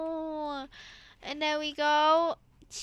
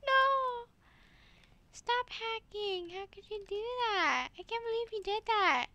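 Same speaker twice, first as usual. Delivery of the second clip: mock outrage; 6 semitones higher, 6 dB quieter than usual. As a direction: pretend to be betrayed, hammed up. Mock outrage at friend's success.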